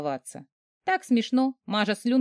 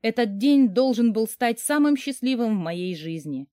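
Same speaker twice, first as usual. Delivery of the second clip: ordinary delivery, nothing marked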